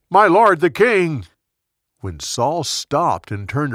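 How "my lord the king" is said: much higher, louder call after the king; yelled call. Acted. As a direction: call out loudly to the king walking away. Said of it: "David went out and yelled".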